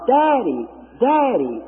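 high-pitched, falling call for Daddy; imitated hollering. Blind girl seeking father in crowd, imitated hollering. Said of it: "heard somebody hollering".

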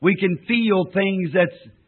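slow, reverent praying voice; prayer. Acted. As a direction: pray it slowly and earnestly. Leads a closing prayer.

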